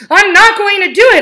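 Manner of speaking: the resolve cracks into a shout; loud outburst. A narrator's reading. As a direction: it bursts out of him. Repeated resolve, building.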